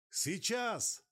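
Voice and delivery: high, loud yell over the wind; shouting over a storm. Acted. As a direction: bellow it against the gale. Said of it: "Гор старался перекричать бурю".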